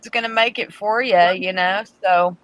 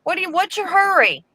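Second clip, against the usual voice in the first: high-pitched scolding little voice; nagging inner voice; 10 semitones higher than usual. Retold or imitated. Retelling her inner voice scolding her for rushing, high nagging voice. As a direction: pipe up high, like a conscience nagging. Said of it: "my little voice kept telling me".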